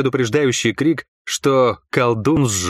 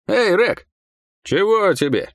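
calling out; 10 semitones higher, slower than usual. A narrator's reading. Guards calling to each other.

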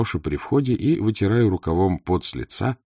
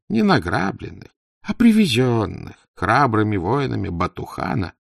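animated, swooping sales pitch of a talkative trader; lively haggler's character voice; scheming poor trader; 6 semitones higher than usual. Acted. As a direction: enthuse about profits, then lament your lot.

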